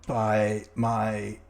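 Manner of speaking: slow, hollow corpse voice; zombie. Acted. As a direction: drag out each word like the dead.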